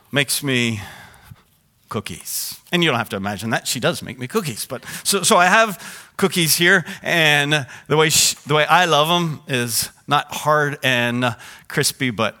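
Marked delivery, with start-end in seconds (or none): none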